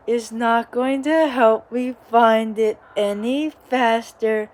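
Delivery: normal talking voice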